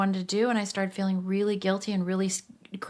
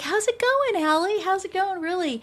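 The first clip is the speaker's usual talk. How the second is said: much higher, lightly sung greeting; mentor voice; 12 semitones higher than usual. Retold or imitated. The mentor in Hawaii asking Allie how she is, high and sing-song. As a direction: sing out a caring check-in. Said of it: "she's like".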